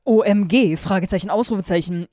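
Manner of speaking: loud, spelled-out cry; shouted exclamation. A narrator's reading. Heroine astonished by the villa, shouting. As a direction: shout each letter out. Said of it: "schrie ich".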